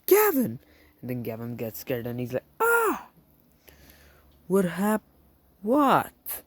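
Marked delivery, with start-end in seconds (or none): 0.0-0.6 very high, loud yell of the name. Acted. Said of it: "comes in and yells". 2.6-6.4 very high, loud startled cry; startled panic. Acted. Gavin wakes up scared.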